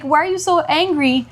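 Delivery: put-on high-pitched child voice; imitated questioning daughter. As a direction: mimic a child questioning a parent. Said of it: "see my daughter like".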